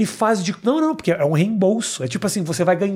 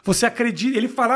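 normal talking voice

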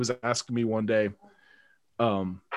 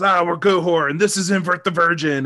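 louder, higher mock frontman announcement; metal singer intro; 6 semitones higher, 6 dB louder than usual. Lead singer grabbing the mic, hammed-up frontman shout. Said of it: "He goes".

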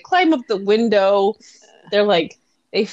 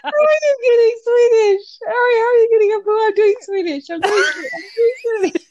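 high-pitched, cracking-up protest; laughing protest; 9 semitones higher than usual. Real, not acted. Co-host insisting it was Swedish, squealing with real laughter. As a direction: keep insisting while overcome with giggles.

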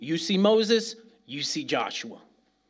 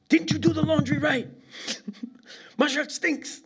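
mock outraged dad; 12 semitones higher than usual. Father yelling at mother over laundry, hammed-up high squeal. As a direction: ham up a father's indignant squeal, laughing through it. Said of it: "all of a sudden he goes".

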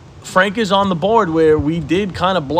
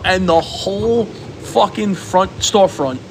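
ordinary talk, nothing marked

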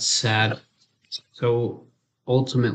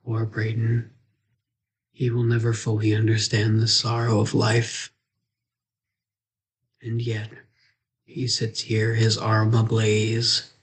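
low, grave storyteller voice; mock solemn narration; 4 semitones lower than usual. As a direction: narrate it like a tragic epic.

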